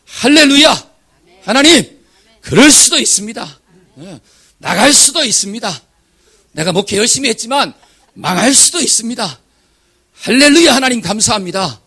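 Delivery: loud, high, ecstatic hallelujah; joyful shouted prayer. Retold or imitated. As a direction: cry out thanks to God at full voice.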